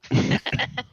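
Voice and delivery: loud high laugh; burst of laughter. Real, not acted. Laughs at racist joke.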